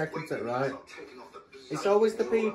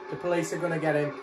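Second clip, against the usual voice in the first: normal talking voice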